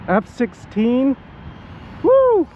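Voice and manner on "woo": a loud, high whoop of delight; excited whoop. Real, not acted. Pilot cheering his plane's pass, a loud high whoop. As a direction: cheer the jet as it screams past.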